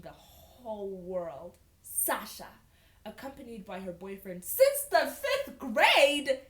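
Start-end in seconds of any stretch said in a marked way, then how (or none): 4.4-6.4 loud, high burst of disbelief; incredulous shout. Real, not acted. Reader balking at her boyfriend since fifth grade, incredulous shout. Reader balks at the story detail.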